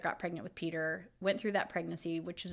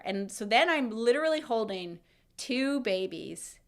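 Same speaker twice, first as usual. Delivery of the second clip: ordinary delivery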